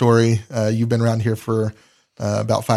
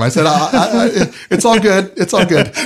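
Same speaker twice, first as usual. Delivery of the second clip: laughing; 7 semitones higher, 5 dB louder than usual. Real, not acted. Laughing while reassuring Kim.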